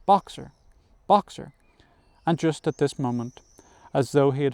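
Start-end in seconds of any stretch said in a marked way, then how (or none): 0.1-1.5 repeated urgent calls, louder and rising higher; narrated cries after someone leaving; crying out. A narrator's reading. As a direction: call the name desperately, climbing each time. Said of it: "she cried".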